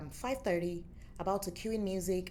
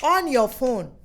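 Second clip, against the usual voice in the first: loud insistence; 6 semitones higher, 12 dB louder, slower than usual. Retold or imitated. Dima repeating the order.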